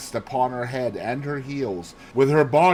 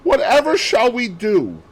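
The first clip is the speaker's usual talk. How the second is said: distressed cry; 10 semitones higher, 7 dB louder than usual. Acted. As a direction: wail it out as she wrings her hands. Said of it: "wringing her hands".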